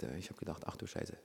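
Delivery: a guilty whispered admission; sheepish whisper. Retold or imitated. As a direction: confess it under the breath. Meek replies to the Holy Spirit.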